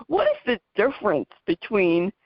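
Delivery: normal talking voice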